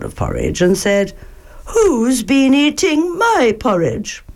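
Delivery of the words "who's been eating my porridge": higher motherly bear voice; higher Mother Bear asking. Acted. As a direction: lift it for Mama Bear.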